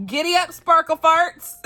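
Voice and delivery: high, loud giddy-up call; hammed-up rallying cry. Reading a funny challenge title aloud, hammed-up rallying cry. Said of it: "I love how I say it".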